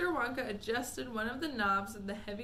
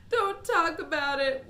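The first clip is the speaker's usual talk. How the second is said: higher, louder wail; 6 semitones higher, 9 dB louder than usual. Acted. Mother wails at her husband, higher and louder. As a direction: plead him to stop. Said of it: "wailed Missus T V".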